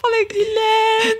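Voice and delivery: high, pleading call on the verge of crying; near tears. Real, not acted. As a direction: call his name as tears rise. Calling husband while near tears.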